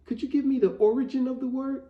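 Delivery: put-on high-pitched, mildly anxious kid's question; imitated nervous boy. Retelling a spelling bee kid stalling, nervous. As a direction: ask like a nervous young contestant. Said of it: "he was so nervous to spell it".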